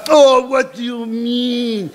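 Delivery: high, slow, falsely innocent French headmistress; crocodile sincerity. Acted. Headmistress feigns surprise to student, insincerely. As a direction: draw it out with sugary fake sincerity. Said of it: "with all the sincerity of a crocodile".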